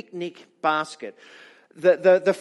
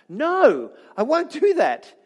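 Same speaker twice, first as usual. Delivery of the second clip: sharp, higher, louder protest; visceral refusal; 8 semitones higher, 6 dB louder, slower than usual. Acted. Peter refusing God's command in his vision, raised protest. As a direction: recoil from the order and refuse it outright. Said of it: "his visceral response is".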